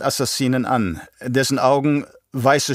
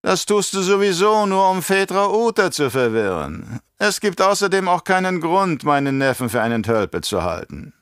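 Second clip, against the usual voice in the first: raised, theatrical voice of a scheming baron; menacing Baron; 9 semitones higher than usual. Acted. Baron scolding his nephew, theatrical and sly. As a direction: play the Baron, condescending and sly.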